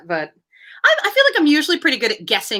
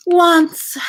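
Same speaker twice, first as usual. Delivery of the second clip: deep sigh; 9 semitones higher, 6 dB louder than usual. Acted. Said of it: "with a deep sigh".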